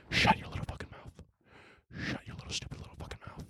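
hissed whisper of a threat; menacing whisper. Acted. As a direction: whisper the threat right into the ear. Threatening critics again.

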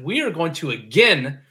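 ordinary delivery

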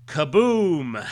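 loud, booming comic-book blast; explosion sound effect. Acted. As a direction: boom it out like an explosion. Comic sound effect read aloud.

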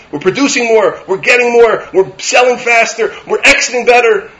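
loud, high, driving sermon voice; preaching at full force. Acted. Rabbi railing at the world's obsession with growth, loud preaching. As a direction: hammer each item home to the crowd.

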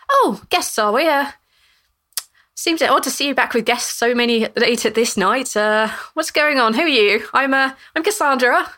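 a hint of a curious old lady; nosy old lady. GM voices Cassandra questioning guests.

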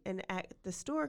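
ordinary talk

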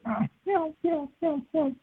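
high, quiet turkey yelps; soft turkey tree yelp. Shown as a demonstration. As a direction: imitate a hen's yelp, very softly. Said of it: "as soft as I could do it".

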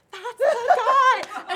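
slow, loud, laughing stage hiss; laughing panicked shout to roommate; laughing mock shout. Frantic whisper-shout to roommate.

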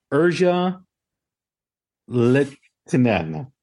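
Urja Litinen sounded out slowly, Litinen stretched long; unsure, drawn-out try at Urja Litinen; careful pronunciation. Shown as a demonstration. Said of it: "making me pronounce it".

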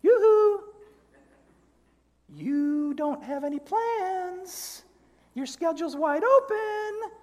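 high falsetto guilt-tripping conscience; angel on the shoulder. Acted. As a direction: coo it very high, stretching the phrases. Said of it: "you hear the one voice that says".

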